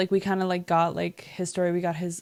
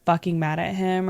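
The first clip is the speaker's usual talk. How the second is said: normal talking voice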